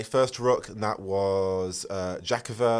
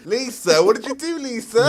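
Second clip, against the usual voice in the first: mock accusing; 12 semitones higher, 9 dB louder than usual. Playful accusation of a friend.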